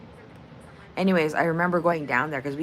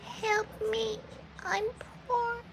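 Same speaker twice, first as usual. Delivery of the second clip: higher, soft pleading voice; much higher, softer mock plea; quoting the movie line; 7 dB quieter, slower than usual. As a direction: beg sweetly and pitifully. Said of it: "that line".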